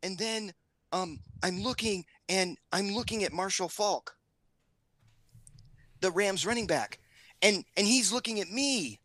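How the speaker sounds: lightly voiced kid, high pitch; a hint of a child building to her big moment; daughter retelling. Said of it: "And she goes".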